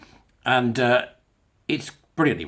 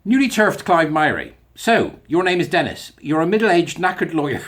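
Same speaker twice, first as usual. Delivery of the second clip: mock Mastermind host, higher and measured; Mastermind quizmaster; 4 semitones higher, 5 dB louder than usual. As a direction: introduce the contestant like a quiz host. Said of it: "rather like Mastermind".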